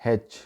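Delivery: हेच with a breathed opening h, haych; native English pronunciation. Shown as a demonstration. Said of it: "इसको गोरे बोलते हैं".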